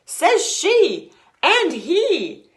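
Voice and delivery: high, loud, slow shout; loud proud shout. Acted. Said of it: "Bismarck shouted".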